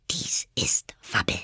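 geflüstert; zu sich selbst. A narrator's reading. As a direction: leise zu sich sagen. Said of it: "sagte sie sich". (Alice flüstert zu sich selbst)

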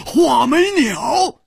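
high, loud, drawn-out cry of astonishment; startled outcry. A narrator's reading. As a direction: blurt it out loudly in shock. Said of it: "吃惊地叫起来，失声说".

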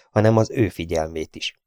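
usual speaking voice

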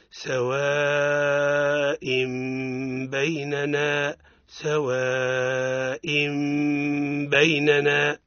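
سواء بيننا with the tanwin turned to a nasal m; iqlab with ghunna. Shown as a demonstration. Teacher modelling iqlab for the class, slow recitation. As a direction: hold the ghunna before بيننا. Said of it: "اسمعوها جيدا".

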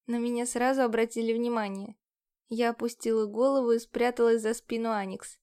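ordinary delivery

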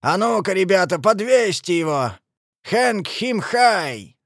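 high, shouted rallying order; rally the gang with a bellow; forceful shout, slowing at the end; roaring command. A narrator's reading. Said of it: "проревел Великий Гонзо".

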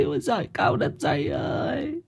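high wail sinking to a soft moan; wailing cry.

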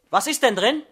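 loud anxious question; alarmed loud. Acted. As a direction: cut her off in alarm.

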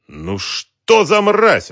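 high, loud, drawn-out cry of disgust; outraged exclamation. A narrator's reading. Woman disgusted by the confessed villain, outraged cry. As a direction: spit it out loudly, stretching the words. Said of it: "воскликнула женщина".

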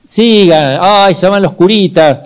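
voz alta y cantada de vecina que despide; despedida cantarina. Retold or imitated. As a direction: llamar a los chicos con cariño desde la puerta. Vecinos despidiendo a los curitas. (despedida cantarina de vecina)

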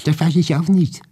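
high neighbor voice. A narrator's reading. Maria Wassiljewna answers.

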